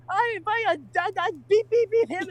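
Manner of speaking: very high-pitched, mock outraged rant; ranting redhead. Friend relaying the redhead's rant, high mock outrage. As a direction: squeal it out, self-censoring with spoken beeps. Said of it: "She goes".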